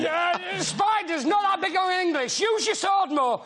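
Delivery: mock director's shouted direction; director calling out. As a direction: call out a note to an overacting auditioner. Imitates director calling out correction.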